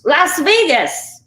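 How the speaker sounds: high, loud, drawn-out burst of enthusiasm; excited exclamation. Real, not acted. Cook naming the best city for Chinese food, excited exclamation. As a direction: exclaim it big and slow, pitch leaping up.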